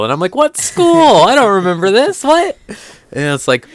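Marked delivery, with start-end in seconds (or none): 0.3-2.6 loud, high cry of mock confusion; incredulous outburst. Lost viewer reacting to a forgotten plot, incredulous. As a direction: blurt it out in high-pitched disbelief.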